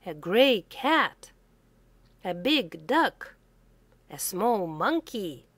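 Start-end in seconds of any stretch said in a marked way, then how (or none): none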